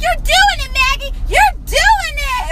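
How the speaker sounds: high, loud imitation of a thrilled child; little girl cheering. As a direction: squeal encouragement like an excited kid. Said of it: "Ariana was like".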